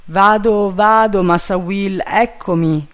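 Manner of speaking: mildly eager servant voice; eager compliance. A narrator's reading. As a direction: answer readily, calling down. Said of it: "rispose sollecitamente il negro".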